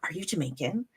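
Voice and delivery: hushed whisper to a stranger; elderly woman whispering softly; whispered question. Retold or imitated. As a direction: lean in and whisper it. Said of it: "she was like".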